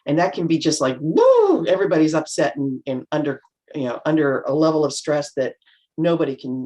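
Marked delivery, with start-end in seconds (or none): 1.0-1.7 high loud mock whoop of chaos; a sudden high, loud whoop; woo. Speaker recalling crisis work, high loud whoop.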